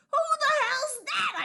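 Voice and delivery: high shocked yell at the painting. Acted. Reporter yelling at the painting in shock. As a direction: shout it out in alarm. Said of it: "I yell".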